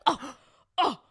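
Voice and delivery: loud, high mock gasps; two loud, high "oh"s gasped in mock offence; scandalized gasping hoots. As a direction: imitate his shocked gasps, sounding like a monkey. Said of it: "you sound like one right now".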